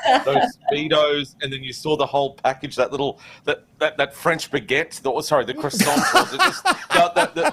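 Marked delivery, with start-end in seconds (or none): 5.6-7.5 giggling. Real, not acted. Giggling over innuendo.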